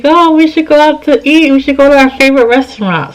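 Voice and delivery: high-pitched, lightly eager suggestion; retold eager invitation. Woman retelling her invitation to her ex, eager. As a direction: pitch it up, keen to make plans.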